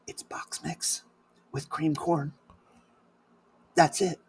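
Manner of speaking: very hushed, secretive reveal; near-silent, conspiratorial murmur; confidential whisper. Retold or imitated.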